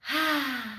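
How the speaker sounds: open-mouthed sigh out; long sigh. Shown as a demonstration. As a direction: let out a long, relaxing breath. Demonstrates bunny breath.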